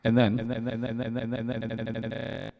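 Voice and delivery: chopped-up glitchy vocal; scatter effect. Shown as a demonstration. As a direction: voice sliced and stuttered. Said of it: "this is called a scatter effect".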